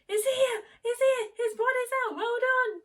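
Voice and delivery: very high, loud, elated cry; joyful announcement. Retold or imitated. Midwife announcing the baby is born, elated and loud. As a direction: burst out with delight at the birth. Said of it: "she just said".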